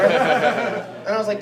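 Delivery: ordinary delivery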